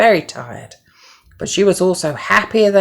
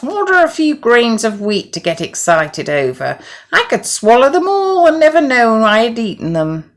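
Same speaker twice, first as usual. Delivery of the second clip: higher, loud, scornful duck voice; disdainful duck; 8 semitones higher, 5 dB louder than usual. Acted. Duck mocks the hen's wheat, scornful. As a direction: brush the grains off as nothing. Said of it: "said the duck in disgust".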